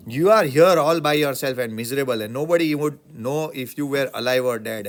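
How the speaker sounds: loud, scornful rant at the teacher; angry outburst. Acted. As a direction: tear into him with loud contempt. Imagined student scolding teacher.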